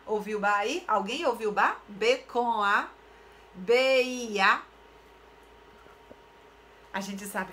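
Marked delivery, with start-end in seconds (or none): 1.9-4.6 "B com A, B I A" drawn out slowly; slow spelling. Shown as a demonstration. Teacher testing the listeners' ears, slow emphatic spelling. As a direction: stretch each letter of "B com A, B I A". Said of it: "Só que quando eu falo".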